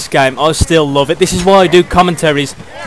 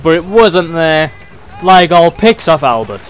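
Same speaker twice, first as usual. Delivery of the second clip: normal talking voice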